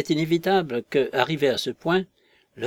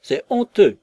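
high, loud cry of outrage; shouting; 9 semitones higher, 8 dB louder than usual. Acted. Bunting cries out inside the parlour, loud and high. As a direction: shout your indignation. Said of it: "cria monsieur Bunting".

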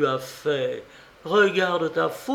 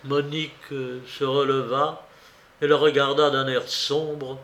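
ordinary delivery

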